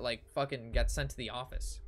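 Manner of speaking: ordinary delivery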